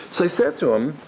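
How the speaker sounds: normal talking voice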